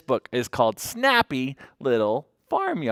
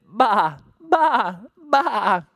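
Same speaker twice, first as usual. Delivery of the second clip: sheep baa; 8 semitones higher, 5 dB louder, much slower than usual. Acted. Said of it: "They greet the morning sun with".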